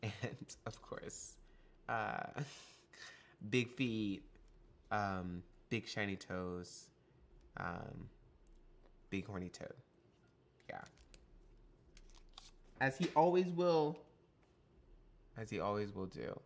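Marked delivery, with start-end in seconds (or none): 0.6-1.3 soft unvoiced whisper of the phrase; whispered aside. Shown as a demonstration. Streamer to chat, whispered aside. 12.8-14.0 loud, high grand announcement; mock proclamation. Streamer reading card flavour to chat, hammed-up and loud.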